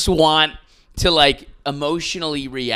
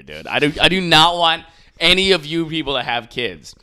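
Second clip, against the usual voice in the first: usual speaking voice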